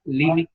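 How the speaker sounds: "límite" as LÍ-mi-te, stress on LÍ; stress on the first syllable. Shown as a demonstration. Teacher summing up the forms, stress on LÍ in "límite". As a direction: hit LÍ at the start of "límite". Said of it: "lleva acento como esdrújula".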